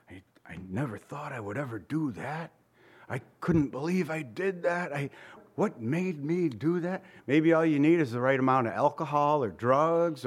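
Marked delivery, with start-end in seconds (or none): 0.1-7.0 inmate's regret. Retold or imitated. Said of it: "these guys say to us".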